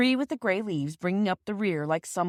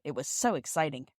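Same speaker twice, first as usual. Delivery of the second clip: plain reading voice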